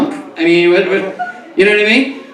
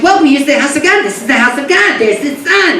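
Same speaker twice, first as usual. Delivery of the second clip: mother's voice; 7 semitones higher than usual. Retold or imitated. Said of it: "she's like".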